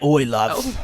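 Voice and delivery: imitated Aussie "Oi"; put-on Australian greeting; mock Australian accent. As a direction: call out like an Australian. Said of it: "They'd be like".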